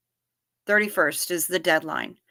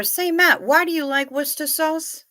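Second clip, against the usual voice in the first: put-on interviewer voice; 8 semitones higher than usual. Mock interview of Matt.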